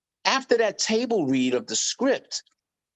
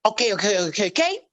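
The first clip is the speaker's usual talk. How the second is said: Joe Pesci; 3 semitones higher, faster than usual. Retold or imitated. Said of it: "Joe Pesci played this character where he kept going".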